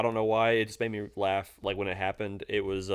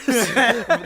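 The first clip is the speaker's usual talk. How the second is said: high burst of laughter; 11 semitones higher, 7 dB louder than usual. Real, not acted. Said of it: "laugh after retelling".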